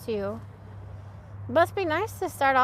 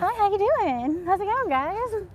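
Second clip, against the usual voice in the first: high, slow, peppy tour guide greeting; 9 semitones higher, slower than usual. Shown as a demonstration. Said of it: "tour guide voice".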